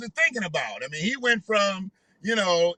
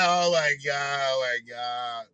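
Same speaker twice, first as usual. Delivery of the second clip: lovestruck sigh; 7 semitones lower, 4 dB quieter, slower than usual. Retold or imitated. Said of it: "that was a little sprung dialect".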